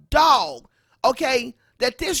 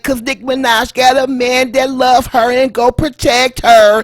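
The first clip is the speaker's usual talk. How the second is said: loud vlog rant; 10 dB louder than usual. Acted. Reacts over loud music.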